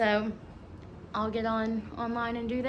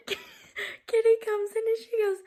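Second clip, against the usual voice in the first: normal talking voice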